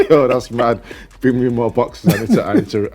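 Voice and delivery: imitating a hyped-up teammate opening cards, loud and high; excited retold teammate. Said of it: "He said".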